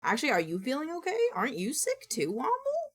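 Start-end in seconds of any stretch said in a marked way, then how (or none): none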